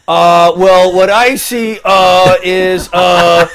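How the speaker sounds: loud, halting mock "uhs"; mock Paul's hesitant pitch with heavy, loud uhs; imitating stammering intern. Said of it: "I don't use that many uhs".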